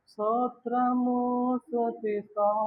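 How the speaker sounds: barely bother to say it; half-hearted praise. Retold or imitated. Limp praise in church. (నీరసంగా స్తోత్రము)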